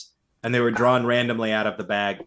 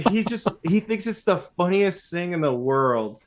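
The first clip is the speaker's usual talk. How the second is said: ordinary talk, nothing marked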